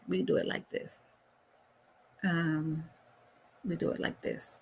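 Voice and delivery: whispered under her breath; muttering to herself. Real, not acted. Host fiddling with settings, murmuring to herself. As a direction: murmur it privately, not for the audience.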